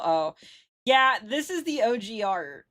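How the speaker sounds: ordinary talk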